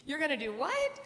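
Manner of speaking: mock stunned question; disbelief. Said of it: "I'm like".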